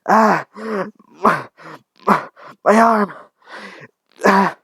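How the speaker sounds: high, loud agonised cries with broken words; acted shrieks of agony; screaming in pain. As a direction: scream out in pain over an injured arm. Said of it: "the lady was screaming".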